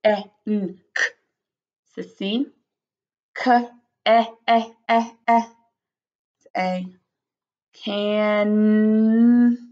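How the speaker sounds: "can" broken into separate letter sounds, then blended long; slow phonics demo of "can"; sounding out. Shown as a demonstration. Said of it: "you can sound it out".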